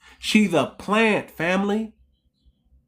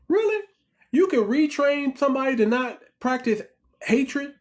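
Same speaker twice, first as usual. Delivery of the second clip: sharp high disbelieving really; incredulous; 7 semitones higher than usual. Real, not acted. Vlogger to family mocking the retraining promise, incredulous. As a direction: scoff at the idea in disbelief.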